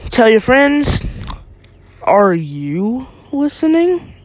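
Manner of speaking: ordinary talk, nothing marked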